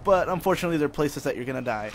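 emphatic cautionary punch; loud mock warning. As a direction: warn the children loudly. Mock lesson to children.

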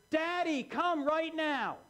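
put-on child calling high and loud, slow on come right now; urgent child's cry. Child calls for father down the hall, urgent high cry. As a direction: call down the hall like a scared child. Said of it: "It's a much more urgent".

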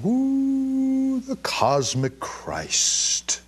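high, drawn-out dreamy guru voice; slow, swooning mock mystic. Mocking a navel-gazing mystic, slow dreamy swoon. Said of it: "as they're going".

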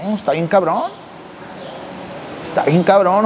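normal talking voice

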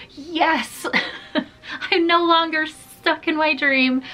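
high, playful cheer with a laugh; mock celebration. Dreamer woken by her dog, playfully relieved cheer. Imagined joy at being woken.

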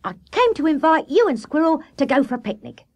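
high-pitched, eager invitation; cheery animal character making an invitation; Water Rat, genial host. Acted.

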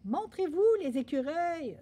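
high, loud bellowed summons; roaring. A narrator's reading. Boy summoning squirrels from the trees, roaring. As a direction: bellow it up at the treetops. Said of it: "rugit Gaspard".

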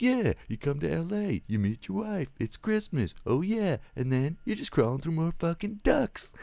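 mock the classic quip in a squeaky parody; squeaky high parody of the cocky hero; mock John McClane. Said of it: "He's like".

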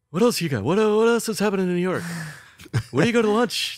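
a mock demanding boss fishing for ideas; imitating Larry. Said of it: "Larry goes".

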